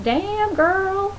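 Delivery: admiring catcall. Retold or imitated. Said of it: "I was just like".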